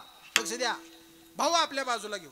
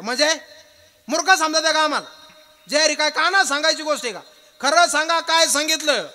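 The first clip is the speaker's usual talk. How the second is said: high, loud confrontational demands; angry disbelief; 4 semitones higher, 10 dB louder than usual. Acted. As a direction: fire outraged questions at someone hiding the truth. Acted quarrel, demands the truth.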